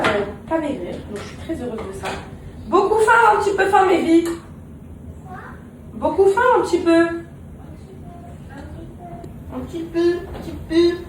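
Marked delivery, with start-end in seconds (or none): 2.7-11.0 much higher, loud teasing sing-song; playful sing-song. Acted. Mom teasing her children in a high sing-song. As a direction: coo it up high like to little kids.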